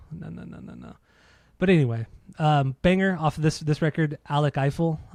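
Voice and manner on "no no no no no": whispered no. Real, not acted. Mock refusal, playful.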